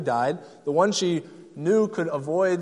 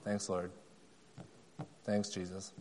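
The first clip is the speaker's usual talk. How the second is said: lower, much softer rote recital; automatic response; 7 semitones lower, 6 dB quieter than usual. Retold or imitated. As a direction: toss it off like a memorized Sunday school answer. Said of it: "She had an automatic response".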